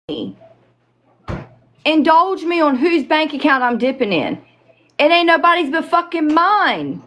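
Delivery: high heated comeback; indignant. Real, not acted. Angry rebuttal to rumor.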